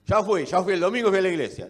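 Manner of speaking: loud, high, mocking tone of someone who's done their duty; smug churchgoer. Preacher mocking a Sunday-only believer, loud and smug. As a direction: bray it like a box-ticking churchgoer. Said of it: "alguien que piensa de esa manera".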